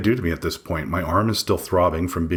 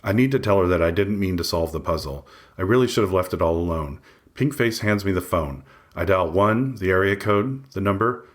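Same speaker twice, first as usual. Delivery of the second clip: ordinary delivery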